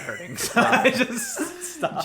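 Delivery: high, slow words through a laugh. Real, not acted. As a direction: let the laugh carry the line up high. Laughing at own story.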